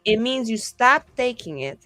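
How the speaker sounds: normal talking voice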